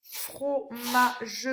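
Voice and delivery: "Fromage" split slowly as fro-ma-ge; teacher's measured syllable split of "Fromage". Shown as a demonstration. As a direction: sound out "Fromage" in three separate syllables. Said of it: "on entend feu sur la première".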